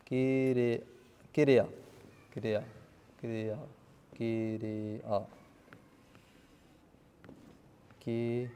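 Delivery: low, soft, broken-up kiriya; quiet sounding-out. Real, not acted. Teacher sounding out the Punjabi word for verb, quietly. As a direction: sound kiriya out under your breath, piece by piece. Repeats word slowly to self, syllables.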